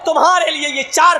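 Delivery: full-force pulpit preaching with chanted Arabic; fiery preaching. Acted. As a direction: thunder the sermon at the congregation.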